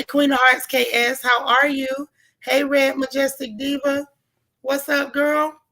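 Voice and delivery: ordinary talk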